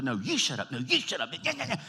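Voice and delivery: hammed-up squabble, climbing into high gibberish; cartoonish spat rising in pitch; mock yelling match. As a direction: bicker like a furious couple, played for laughs. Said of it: "you're yelling and you're screaming at each other".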